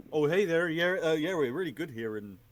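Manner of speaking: put-on loud, high, hammy Canadian accent; big friendly Canadian accent, played up. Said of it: "My Canadian accent is not great".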